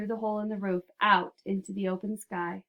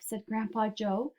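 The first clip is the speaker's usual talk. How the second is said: ordinary delivery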